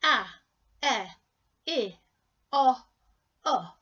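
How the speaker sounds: each short vowel "a, e, I, O, U" said as a separate clipped sound; one short vowel at a time, clearly. Shown as a demonstration. Teacher drilling the short vowel sounds for learners. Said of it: "They have the short sound".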